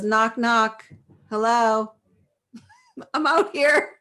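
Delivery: loud, playful knock-knock call; imitated husband calling. Wife imitates husband reaching out to her, calling playfully. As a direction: call in to someone shut away, laughing.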